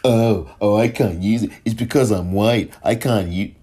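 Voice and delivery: mild mock lament; mocking whine. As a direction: parody the self-pitying complainer.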